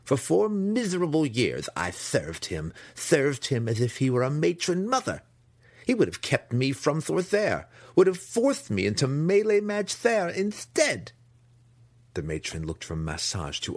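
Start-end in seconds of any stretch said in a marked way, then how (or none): 0.0-11.1 high, loud, lisping tirade; bitter outburst. A narrator's reading. Son rants to his mother about his brother, bitter and high. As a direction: build an aggrieved rant, higher and louder to the end. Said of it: "Massage spat".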